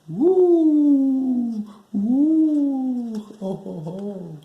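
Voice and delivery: shrieking owl calls then a chuckle; owl hoots. Acted. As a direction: hoot like an owl from a treetop.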